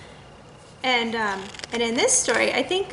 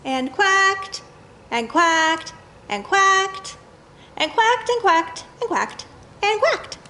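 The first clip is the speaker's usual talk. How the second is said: lively repetition; 7 semitones higher, slower than usual. Acted. Repetitive ending read to kids.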